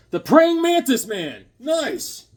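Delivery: high, drawn-out villain announcement; gleeful reveal. Real, not acted. Telling who Blue Beetle is fighting, gleeful high announcement.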